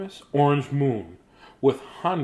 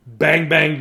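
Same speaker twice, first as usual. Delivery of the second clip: usual speaking voice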